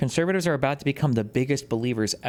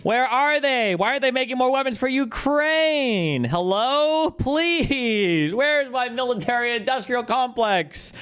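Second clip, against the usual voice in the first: high, loud whiny plea; high, loud sarcastic whine, drawn out; mock pleading hawk; 14 semitones higher, 4 dB louder, slower than usual. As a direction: beg for weapons in a squeaky mock voice.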